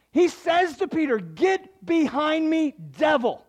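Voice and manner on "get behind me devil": loud, high, slow stern rebuke; strongest rebuke. Retold or imitated. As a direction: slam each word down as a rebuke.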